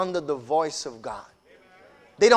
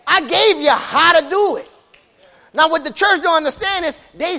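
loud, high, shouting sermon climax; full-force preaching; 8 semitones higher, 6 dB louder than usual. Acted. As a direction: whip the congregation up, shouting.

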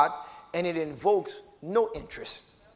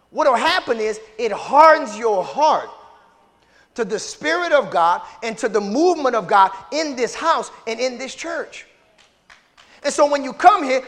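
loud, rising preacher's cry with amen beats; preaching at full force; 5 semitones higher, 9 dB louder than usual. Acted. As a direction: build it up, shout the sacrifice. Preacher builds again.

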